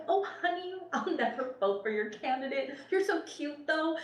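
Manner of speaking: put-on high, sweet older woman saying honey; sugary high lady's voice; doorstep lady voice. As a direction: coo it like a kindly neighbor at her door. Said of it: "this woman was like".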